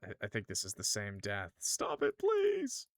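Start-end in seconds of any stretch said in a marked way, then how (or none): 1.6-2.9 much higher, begging clown; frantic high-pitched begging; desperate plea. Acted. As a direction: plead for it to stop. Clown begs in distress.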